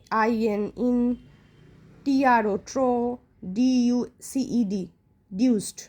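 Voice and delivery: letters of "ইন্ট্রোডিউসড", each group followed by its syllable; spelled out by syllable. Shown as a demonstration. Teacher breaking "ইন্ট্রোডিউসড" up for pupils, spelled syllable by syllable. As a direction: spell and sound "ইন্ট্রোডিউসড" piece by piece, pausing between. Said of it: "আলাদা আলাদা করে".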